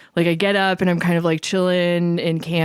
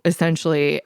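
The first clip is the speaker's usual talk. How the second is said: ordinary talk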